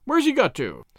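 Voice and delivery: mild cry of puzzlement; surprised cry. A narrator's reading. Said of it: "cried the inspector".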